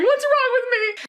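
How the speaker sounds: high panicked plea; a kid frightened by her racing body; scared child. Retold or imitated. Jittery kid calling mom at work in a panic. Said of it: "getting really scared".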